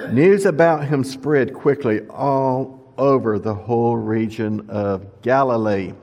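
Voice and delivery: ordinary talk, nothing marked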